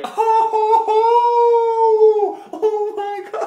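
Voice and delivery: imitating the stunned crowd's shrieks; crowd going wild. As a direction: play the whole gym losing it. Crowd reacts to the dunk.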